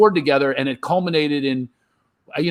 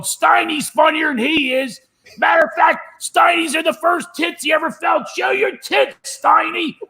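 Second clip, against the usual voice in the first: high, rowdy yelled heckle; drunk heckler. Retold or imitated. Drunk friends heckling comic on stage, rowdy high yell. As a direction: bellow it from the cheap seats.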